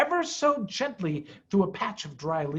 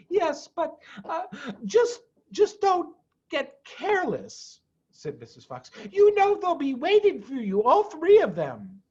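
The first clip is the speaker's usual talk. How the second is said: much higher, anxious and pleading; worried Mrs Fox; 10 semitones higher than usual. Acted. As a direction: beg him to take care. Mrs Fox warns him.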